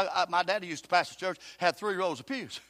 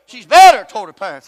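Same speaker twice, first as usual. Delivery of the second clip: She's bitter toward her parents, thrown out loud with weight on bitter; loud repeat; 3 semitones higher, 21 dB louder than usual. Acted. Preacher repeating it loudly to stress bitterness. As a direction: repeat it as a shout to the pews.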